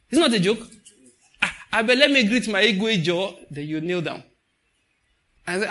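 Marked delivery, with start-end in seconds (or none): 1.4-3.4 loud, raised mock-reverent greeting; hammed-up respectful hail; playful homage. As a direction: pay joking tribute to a king. Acting playful homage before kneeling.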